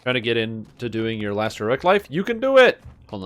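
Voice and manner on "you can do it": high, loud cheer of encouragement. Real, not acted. Streamer cheering a viewer on in chat, loud and high. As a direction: pump them up with a shout.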